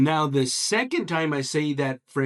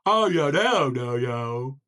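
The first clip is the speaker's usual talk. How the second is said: goofy wide-open vowels, falling away; big-mouthed frog; slower than usual. Acted. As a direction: enunciate it with a huge open mouth. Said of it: "The big-mouthed frog answers".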